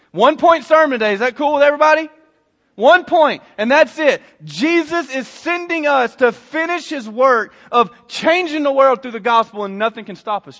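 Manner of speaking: punchy, impassioned sermon point; forceful preaching. Real, not acted. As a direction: proclaim it boldly to the church.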